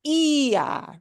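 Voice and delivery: "ia" as a drawn-out EE-ah; "ia" with the weight on the i; stressed i in ia. Shown as a demonstration. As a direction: punch the i of "ia", two slow syllables. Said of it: "we're used to".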